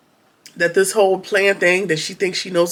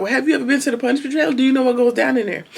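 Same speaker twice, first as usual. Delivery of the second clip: taunting quickly; 6 semitones higher, faster than usual. Retold or imitated. Ruth taunts Bridget.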